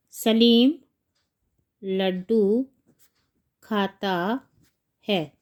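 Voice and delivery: one word at a time, long pauses; slow dictation. Shown as a demonstration. Teacher writing Saleem's sentence, slow dictation. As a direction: place each word separately, rising till the last.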